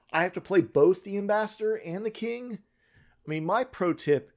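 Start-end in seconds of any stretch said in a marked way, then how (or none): 0.0-2.6 higher, laughing admission. Real, not acted. GM laughs about playing both NPCs at once. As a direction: chuckle through the complaint.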